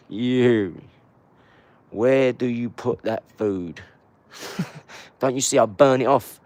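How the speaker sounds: loud taunt. Acted. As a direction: yell it mockingly. Taunting rival about food.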